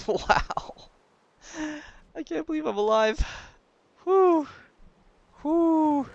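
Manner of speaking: high, loud relieved gasps; relieved disbelief. Real, not acted. Gamer barely survives, high breathless relief. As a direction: gasp out the relief. Just survived a close call.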